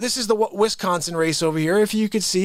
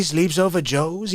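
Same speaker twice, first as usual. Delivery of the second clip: normal talking voice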